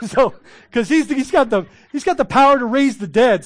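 laughing at the cemetery joke. Real, not acted. Laughing at his own joke.

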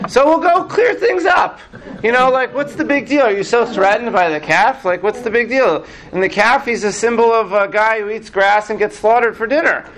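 high, animated scoffing; dismissive Moses. Acted. Moses asks God what's the big deal, dismissive. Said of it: "what does the Midrash make Moses say".

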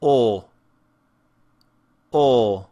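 loud, unchanging "O" with no glide; pure O vowel. Shown as a demonstration. Teacher models the letter O sound, loud pure "O" without glide. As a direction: hold a pure "O", mouth shape constant. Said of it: "and the sound is".

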